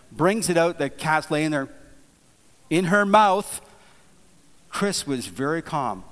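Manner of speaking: ordinary talk, nothing marked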